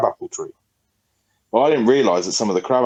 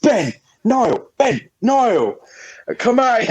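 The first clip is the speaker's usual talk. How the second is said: calling out angrily; 13 semitones higher, much slower than usual. Retold or imitated. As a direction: shout for the boys to come out.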